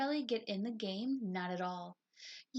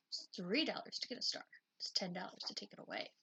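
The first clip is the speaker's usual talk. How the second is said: fast, soft unvoiced whisper; hushed aside to the audience; 3 semitones lower, 7 dB quieter, faster than usual. Real, not acted. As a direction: let the listener in on the con. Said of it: "aside to listeners".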